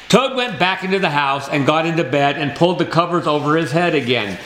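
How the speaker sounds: ordinary delivery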